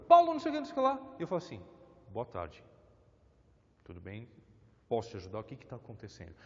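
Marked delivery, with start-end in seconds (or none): 0.1-1.1 high, loud angry yell. Retold or imitated. Someone yelling at Paulo, loud furious shout. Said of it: "uma pessoa gritando comigo". 2.1-6.3 lower, softer and calm. Shown as a demonstration. Paulo calming an angry visitor, soft and calm. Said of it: "se eu mantiver o tom de voz um pouco mais calmo".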